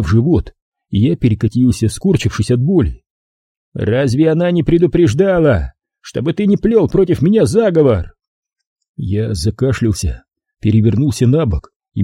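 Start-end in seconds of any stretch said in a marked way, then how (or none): 3.7-8.1 mildly menacing, higher-pitched question; lightly voiced captor, raised pitch; taunting captor. A narrator's reading. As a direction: needle him with a raised, questioning voice. Captor taunts beaten narrator.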